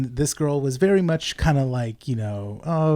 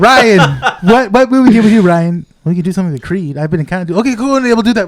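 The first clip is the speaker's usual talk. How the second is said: mock star calling; 6 semitones higher, 12 dB louder than usual. Mock call to director.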